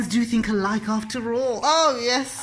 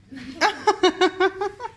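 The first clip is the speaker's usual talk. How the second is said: witch cackle; 10 semitones higher than usual. Pretend. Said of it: "I sound exactly like the Wicked Witch of the West".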